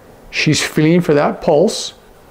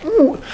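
high pained ooh. Shown as a demonstration. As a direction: let out a high, wincing ooh. Shows facial expression for pain.

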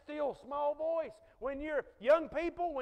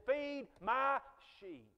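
solemn charge; 3 semitones higher, much slower than usual. Retold or imitated. Jesus's final charge to Peter.

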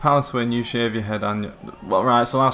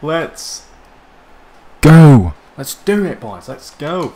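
high, loud rallying yell; punchy bursts of elation; celebration shout; 5 semitones higher, 13 dB louder, much slower than usual. Real, not acted. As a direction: roar the team on after a goal. Celebrating a goal.

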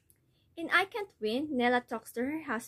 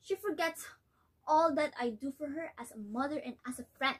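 worn-out mother, resigned and hurt; disappointed mother; weary mother; 3 semitones higher than usual. Acted. Nella recalls obedience, despairs of daughter.

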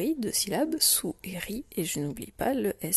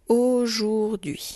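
"aujourd'hui" very slow and louder, each syllable stretched; slow dictation; 3 semitones higher, 6 dB louder, much slower than usual. Shown as a demonstration. Teacher dictates a difficult word. (dictée très lente d'« aujourd'hui », syllabes étirées)

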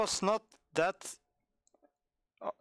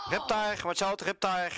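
warning call-out; 4 semitones higher than usual. Real, not acted. Calling out a danger to teammates.

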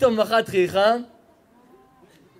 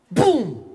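loud boom with a steep falling pitch; imitated explosion boom; 2 semitones higher, 5 dB louder, much slower than usual. Storyteller imitating a bus blowing up in the abyss, loud boom. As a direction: boom out the crash.

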